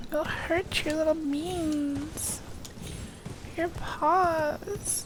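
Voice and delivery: soft slow coo to a pet. Real, not acted. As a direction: fuss over little paws tenderly. Cooing at pet.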